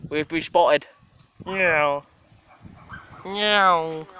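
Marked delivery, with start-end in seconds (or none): 1.4-4.1 loud meow. Real, not acted. Meowing at the cat.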